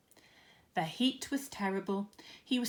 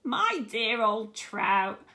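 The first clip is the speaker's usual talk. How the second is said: slow, drawn-out mocking jab; mock-sweet insult, slow; mocking Aunt Sponge; 7 dB louder, much slower than usual. A narrator's reading. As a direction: sneer it out like a vain old aunt. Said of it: "Aunt Sponge cried out".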